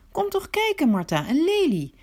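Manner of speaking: high, gushing mock of her dreamy sister; mocking Maria. Martha mocking her sister's delight, high mock gushing.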